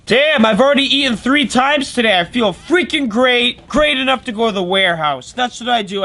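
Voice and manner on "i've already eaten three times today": ordinary talk, nothing marked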